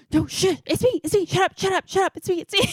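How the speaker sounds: high frantic imitation of the brother's friend; panicked friend. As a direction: imitate a panicking friend begging her to shut up. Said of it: "He goes".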